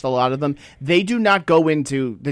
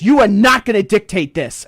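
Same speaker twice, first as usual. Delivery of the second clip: higher, louder bossy bark; mock stern order; 6 semitones higher, 7 dB louder than usual. TSA woman scolds traveler, louder mock barking. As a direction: lay down the law as the rude agent.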